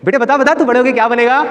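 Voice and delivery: put-on high-pitched adult quizzing a child; coaxing relative. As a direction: imitate a relative egging a kid on. Relatives coax child before audience.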